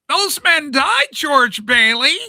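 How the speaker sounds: high, loud hammed-up accusation; Jimmy Stewart scene. Host acting out the film's angel scene. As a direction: throw the line at George like a verdict. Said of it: "he goes through anger, happiness, sorrow".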